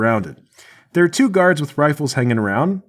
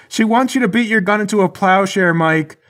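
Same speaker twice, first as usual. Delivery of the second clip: high-pitched relayed message; calling out; 9 semitones higher than usual. Acted. Charlie relaying her demand to Mike, calling out. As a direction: holler it along to your partner.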